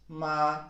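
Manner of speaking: "ma" held high and level; first tone. Shown as a demonstration. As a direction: say "ma" on one high, sustained note. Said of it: "it starts high".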